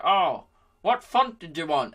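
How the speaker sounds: put-on laptop as an unhurried old man; slow old dude. Laptop asks its owner what font, slow old dude. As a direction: put on a slow old-timer asking politely. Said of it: "like, some old dude taking notes".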